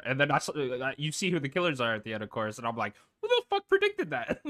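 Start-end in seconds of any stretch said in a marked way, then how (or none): 3.2-4.4 high-pitched incredulous outburst. Retold or imitated. Scoffing at reviewers who called it predictable, high and incredulous. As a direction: squeal it up high in disbelief.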